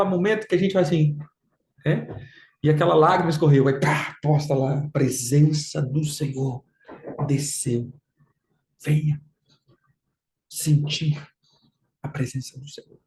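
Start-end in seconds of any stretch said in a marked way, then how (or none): 4.9-13.0 paródia grave e lenta de legenda de culto; postagem devota encenada. Retold or imitated. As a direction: declame baixo, com pausas solenes entre as palavras. Imita postagem emotiva do culto. (falsa solenidade devota, sumindo no fim)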